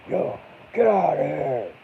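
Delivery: loud, high, slow shout to chase off an animal; shooing yell. Retold or imitated. Yelling in the road to scare the coyote away, loud and high. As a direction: bellow it out in the road, arms up. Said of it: "I'd go out there and get in the road and be like".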